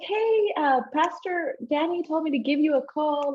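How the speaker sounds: high, cheery caller voice; friendly phone voice. Retold or imitated. Calling the young lady for the pastor, extra cheerful. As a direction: put on a sunny greeting.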